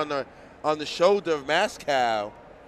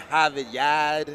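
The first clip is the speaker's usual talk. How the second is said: slow, R-less Boston "Harvard Yard"; Boston accent; 3 dB louder, slower than usual. Shown as a demonstration. Showing off his Boston accent to Steve, slow Boston drawl. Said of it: "so we say".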